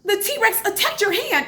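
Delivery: high-pitched tot reporting pretend danger; imitating small child. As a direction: play a child raising the alarm. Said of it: "she'd be like".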